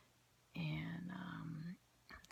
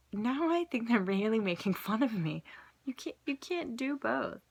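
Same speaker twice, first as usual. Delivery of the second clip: mock exasperation; 4 semitones higher, 6 dB louder than usual. Exasperated at the cards.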